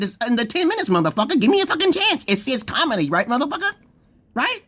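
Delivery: high heated comeback at critics; mock retort. Answering complaining viewers, high mock-angry retort. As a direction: snap back high and indignant. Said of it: "I said".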